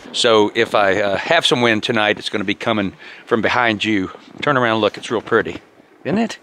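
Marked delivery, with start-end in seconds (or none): none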